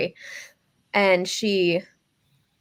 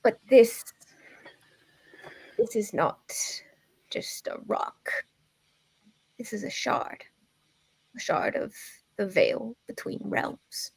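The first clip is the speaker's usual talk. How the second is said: put-on old lady voice; 3 semitones higher than usual. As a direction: gently whisper like a crone. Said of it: "my old lady voice".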